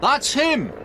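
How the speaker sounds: high, loud excited shout. A narrator's reading. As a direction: yell it as you spot the thief. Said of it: "shouted Frank excitedly".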